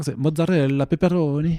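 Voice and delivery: hammed-up Italian accent. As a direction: say it like a cartoon Italian. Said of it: "faire mon petit accent italien aussi".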